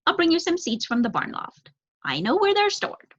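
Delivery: high offering tone; eager young crow. Acted.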